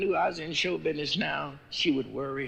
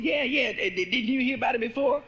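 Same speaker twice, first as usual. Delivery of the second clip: excited stammer; 9 semitones higher, 5 dB louder than usual. Acted.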